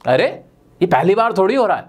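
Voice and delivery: usual speaking voice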